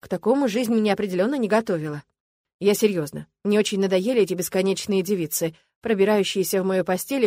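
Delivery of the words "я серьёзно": usual speaking voice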